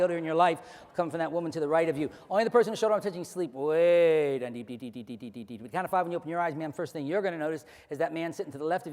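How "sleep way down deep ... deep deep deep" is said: hypnotic induction. Acted. Hypnotist sending a volunteer deep, fading drone. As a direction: fade them down into sleep.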